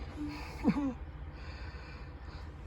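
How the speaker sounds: quiet breathy chuckle at the hokey cutout; hushed amused laugh; soft laugh. Real, not acted. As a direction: laugh softly, half to yourself.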